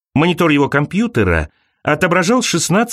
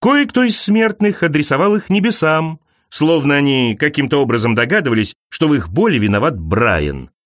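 plain reading voice

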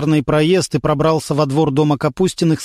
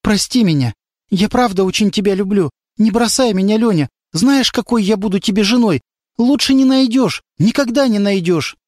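higher, begging on her knees; pleading girl; 7 semitones higher than usual. A narrator's reading. The girl kneels and begs Lyonya, pleading. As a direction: plead with him not to leave. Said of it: "опустилась перед Лёней на колени".

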